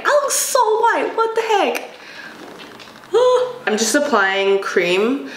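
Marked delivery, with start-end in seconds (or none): 0.0-1.9 high, disbelieving squeal; shocked. Real, not acted. Shocked at pale face. 3.1-3.6 sharp high gasp of surprise. Real, not acted. Reacting to mirror.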